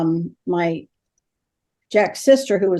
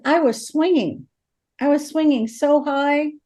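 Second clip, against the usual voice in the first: higher voice imitating a small child; little girl; 4 semitones higher than usual. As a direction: retell it as a little girl would, pitched up. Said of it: "a little girl who's seven years old".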